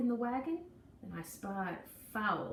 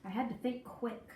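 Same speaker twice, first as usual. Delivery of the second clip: ordinary delivery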